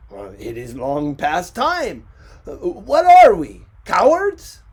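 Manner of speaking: building to a loud, high cry; defiant outburst. Acted. Ricardo asking the world, building to a loud cry. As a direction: rise to an angry challenge.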